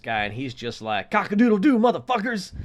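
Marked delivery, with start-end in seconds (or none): 1.1-2.5 high, loud rooster-like boast; cocky crowing. Retold or imitated. Bike guy lording it over the whole bus, cocky crowing. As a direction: crow it out in mock triumph. Strutting old man crowed, retold.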